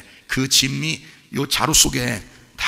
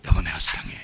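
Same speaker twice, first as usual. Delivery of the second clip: low, whispered love to his wife; 10 semitones lower, 3 dB quieter than usual. Retold or imitated. Husband whispering love to his wife on their anniversary. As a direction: breathe it to her softly.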